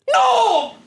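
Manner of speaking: high, sharp shout of despair; anguished shout. Real, not acted. Streamer sees the wrong legend, shouting in despair. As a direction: cry out as hope collapses.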